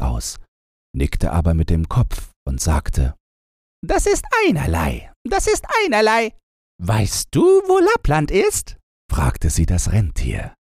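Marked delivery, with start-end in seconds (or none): 3.9-5.1 higher, shrugging dismissal; offhand robber girl. A narrator's reading. Robber girl shrugs off the news, higher offhand voice. 5.2-6.3 faster and even higher, brushing it off; quicker, higher dismissal. A narrator's reading. Robber girl repeats herself, faster and higher. 6.8-8.8 rising high question; robber girl asking. A narrator's reading. Robber girl asks the reindeer, high question.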